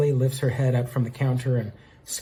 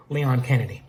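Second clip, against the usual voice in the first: ordinary talk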